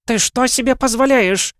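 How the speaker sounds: high, loud furious shout at her husband; a wife yelling at her husband on the phone. A narrator's reading. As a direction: scream it at him down the phone. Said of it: "закричала она".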